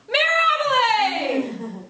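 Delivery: loud, high, drawn-out shout; mock student cheer. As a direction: bellow it like a rowdy freshman. Acts freshman boys shouting it.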